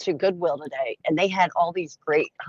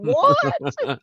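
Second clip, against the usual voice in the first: very high squeaked what; shocked what. Retold or imitated. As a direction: shriek the word in disbelief. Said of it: "I was like".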